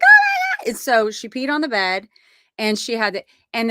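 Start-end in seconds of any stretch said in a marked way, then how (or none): 0.0-0.6 very high, loud shriek; mock scream. Tori shrieking at the dog, very high and loud. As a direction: let out a startled shriek. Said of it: "Tori was like".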